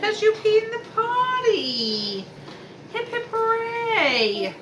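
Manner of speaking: high, slow, excited cheering; potty training praise. Real, not acted. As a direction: cheer him on, big and sing-song. Mom praising son's potty success.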